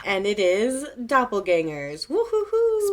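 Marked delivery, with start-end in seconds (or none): 2.1-2.9 high wavering ghostly woo; spooky ghost hoot. Pretend. Spooky theme announced.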